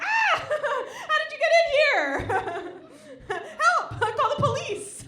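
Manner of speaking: very high, loud fake terror; hammed-up panicked scream; mock scream. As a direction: shriek for help like she's been ambushed. Screaming at boyfriend.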